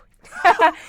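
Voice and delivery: loud, slow, high reveal; emphatic punchline. Real, not acted. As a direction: land the twist loudly. Punchline reveal, laughter around.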